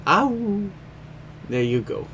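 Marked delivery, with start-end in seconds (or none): 0.1-0.7 high little wolf-pup howl. Pretend. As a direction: give a tiny pup's awoo. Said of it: "I will give you an awu".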